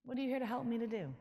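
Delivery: lightly hammed-up curious asking. Shown as a demonstration. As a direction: ask it with bright curiosity. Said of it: "get curious".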